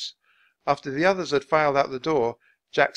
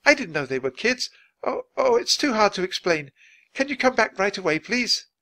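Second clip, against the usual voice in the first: high, loud, harried pleading; flustered Nancy; 10 semitones higher, 6 dB louder than usual. Acted.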